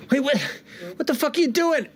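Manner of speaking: very high, indignant gnome voice; alarmed gnome. Acted. Gnome protesting to the half-orc, high-pitched alarm. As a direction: squawk it as a startled little gnome. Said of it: "The other gnome goes".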